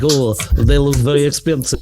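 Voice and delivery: put-on Russian accent. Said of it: "I don't know what accent that is".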